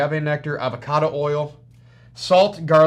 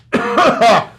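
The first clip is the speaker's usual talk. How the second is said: coughing; 7 semitones higher, 11 dB louder than usual. Real, not acted. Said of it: "Something went down the wrong pipe".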